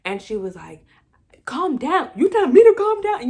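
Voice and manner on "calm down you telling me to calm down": high, loud, outraged squawk; imitated yelling. Wife yelling at ex and therapist, outraged shout. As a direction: rush it out in a high, incredulous yell. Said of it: "She was yelling at both of them".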